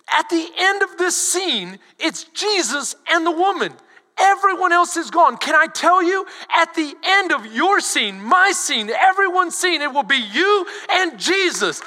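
ordinary delivery

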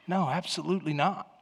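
very soft spoken denial; gentle reassurance. Shown as a demonstration. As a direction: answer a spouse quietly to reassure. Models spouse's reassuring answer.

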